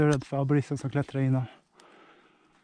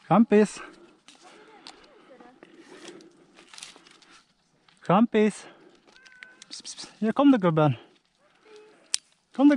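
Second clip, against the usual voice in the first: raised, higher voice calling up into a tree; calling to a cat; 7 semitones higher than usual. Real, not acted. (ropar högt och ljust på katten uppe i trädet)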